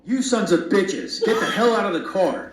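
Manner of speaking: high-pitched angry outburst at ordinary volume; narrated angry outburst. A narrator's reading. Mom cursing the men in the car, high angry voice. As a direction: read the cursing in a high, put-on voice. Mother's furious shouting in story.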